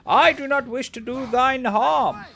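mock noble warrior, weary of being pestered; lightly voiced archaic hero. Slow lofty hero shooing off a pest.

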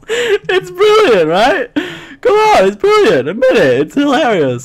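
high, squeaky, amused insistence; gleeful insistence. Real, not acted. Joker pressing his friend to admit it's brilliant, gleeful insistence. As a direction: swoop high as you push them to agree. Pleading that own joke is funny.

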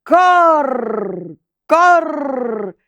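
high raspy crow caws, then a cry; high cawing crow call; crow's cry. A narrator's reading. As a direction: caw like a crow, then shout. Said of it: "крикнув Ворон".

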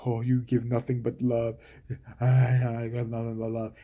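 low, soft, syrupy lover voice; mock tender husband. Mocking the husband's love line to his burned wife, low and soft.

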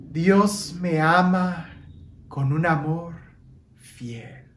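gentle meditative voice, last word breathed; guided meditation. Acted. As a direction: guide the congregation through each breath.

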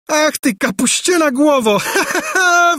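very high mocking cackle; scornful laugh. A narrator's reading. Angelika laughs scornfully at the prince, very high. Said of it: "wybuchnęła księżniczka szyderczym śmiechem".

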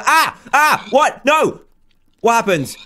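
high, loud shocked cries; startled yelps. Real, not acted. Gamer suddenly trapped in a spider web, startled yelps. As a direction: yelp in sudden alarm.

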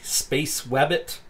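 slow "Space Wabbit", W sound for the R; Wabbit as spelt. Shown as a demonstration. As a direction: read "Wabbit" slowly, with a W, exactly as it's spelt. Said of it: "They spelt it W A B B I T".

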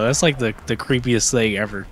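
ordinary delivery

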